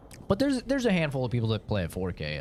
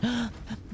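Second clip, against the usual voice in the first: 1 gasp; 13 semitones higher, 3 dB quieter than usual. Retold or imitated. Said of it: "Kenzie goes".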